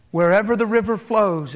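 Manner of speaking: normal talking voice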